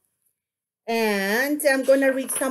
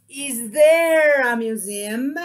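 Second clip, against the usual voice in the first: stretched "there", "museum" climbing at the end; drawn-out rising model ending on "museum"; question intonation; 3 dB louder than usual. Shown as a demonstration. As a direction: slowly lift "museum" as a question. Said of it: "You see my intonation?".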